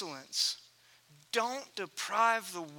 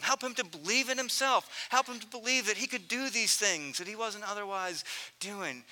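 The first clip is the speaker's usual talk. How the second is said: ordinary talk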